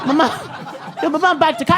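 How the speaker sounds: put-on high-pitched kid's protest; insistent child. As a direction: argue back like a stubborn kid. Quarrel replayed, child insists.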